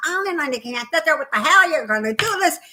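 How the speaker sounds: put-on een overdreven New Yorks accent, hoog en fel. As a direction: doe een opgewonden New Yorkse vrouw na. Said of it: "I was talking like this".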